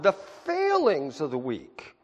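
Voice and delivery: "the failings of the weak" drawn out slowly with weight on "failings"; stressed, slowed down. Shown as a demonstration. Pastor to the congregation, leaning slowly on "failings". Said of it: "I want to stress that word".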